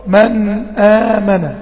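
slow drawn-out recitation of "من آمن"; Quran recitation demo. Shown as a demonstration. Teacher reciting "من آمن" for students, slow and drawn out. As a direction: recite "من آمن" slowly, holding the nun briefly. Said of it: "أو أقول".

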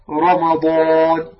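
"رمضان" with a full, heavy ra, drawn out slowly. Shown as a demonstration. Teacher models a word from the verse for students, heavy ra. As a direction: stretch "رمضان" slowly, ra thick and emphatic. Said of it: "هكذا، فأي راء مفتوحة تفخم".

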